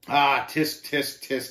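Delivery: tutting in mock pity; tut-tut at the loser. A narrator's reading. Shame belittling the pilgrim's restraint, mocking. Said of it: "he mocked".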